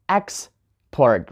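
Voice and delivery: export as EX-port, EX held apart; stress on first syllable. Shown as a demonstration. Teacher showing the noun, export stressed on EX. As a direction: hit the EX in export. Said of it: "On the first syllable".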